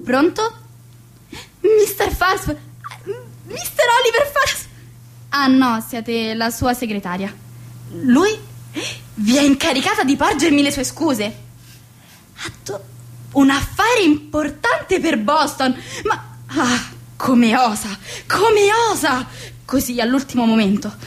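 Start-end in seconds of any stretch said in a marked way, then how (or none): 1.6-4.7 high-pitched, breathless awe at the caller; flustered delight. Acted. Hostess hearing her prized guest is calling, thrilled and flustered. 16.5-19.2 outraged indignation. Acted. Outraged at guest cancelling.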